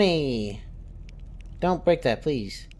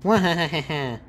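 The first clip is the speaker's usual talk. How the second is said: mad scientist. Pretend. Evil laugh while counting prays.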